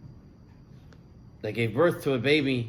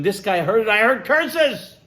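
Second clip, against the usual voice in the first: put-on anguished boy; 7 semitones higher, 5 dB louder than usual. Boy tells doctors why he fainted, anguished. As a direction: cry out the pain of hearing curses.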